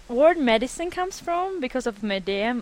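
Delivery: usual speaking voice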